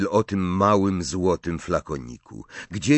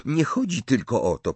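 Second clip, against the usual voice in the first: lightly voiced higher Hermione; 6 semitones higher than usual. A narrator's reading. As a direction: raise the pitch a little to object. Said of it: "powiedziała Hermiona". (lekko zagrana Hermiona, wyższym głosem)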